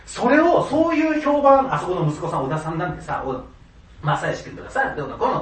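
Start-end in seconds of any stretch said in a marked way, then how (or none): 1.7-5.4 gossiping neighbour. Retold or imitated.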